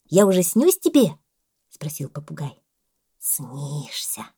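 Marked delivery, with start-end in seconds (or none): 0.1-1.1 loud, emphatic parrot question; eager parrot. A narrator's reading. Parrot eagerly asks the boa. 3.2-4.3 soft, low one-word answer; dreaming boa. A narrator's reading.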